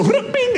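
very high comic ping; vanishing bing. Retold or imitated. People so heated they vanish mid-argument, high-pitched bing. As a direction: chime out a sudden high bing. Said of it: "they just go".

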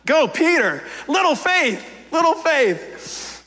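root for him like a proud coach; cheering. Acted. Jesus cheering Peter on, lightly voiced pride. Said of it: "This is not an insult".

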